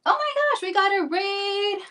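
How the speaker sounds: high squeal of surprise; surprised. Real, not acted. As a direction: gasp it out, delighted. Surprise at an incoming raid.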